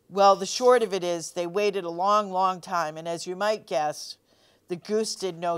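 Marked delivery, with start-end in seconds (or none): none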